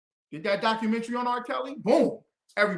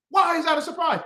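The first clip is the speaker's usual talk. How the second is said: indignant shout; 7 semitones higher than usual. Real, not acted. Challenging the man who got mad at him, indignant shout.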